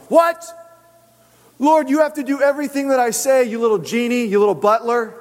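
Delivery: high, outraged bossing; mock indignant demand. Acts out bossy believer refused.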